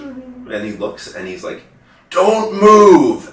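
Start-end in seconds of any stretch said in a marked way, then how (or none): 2.1-3.3 loud, high-pitched imitated order; alarmed shout. Retold alarmed eye examiner's order.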